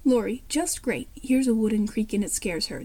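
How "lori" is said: ordinary delivery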